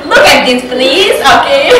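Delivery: loud, laughing imitation of a bragging Russian; a friend imitates Russians flaunting money, loud and laughing; mock Russian show-off. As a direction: shove your riches in their face, half laughing. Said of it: "Russian people like".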